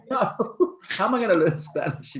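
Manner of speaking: laughing mock protest. Long-time resident retelling a reply to locals, laughing mock protest. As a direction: protest in amused exasperation, half laughing.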